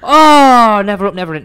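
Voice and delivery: loud, high wailed "oh"; mock commiseration. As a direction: heckle a short putt with exaggerated dismay. Mock heckling of a missed putt.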